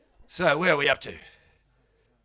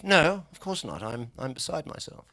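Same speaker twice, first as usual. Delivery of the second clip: mock posh English; 2 semitones lower than usual. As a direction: put on a toff's calm. [name] now speaks posh.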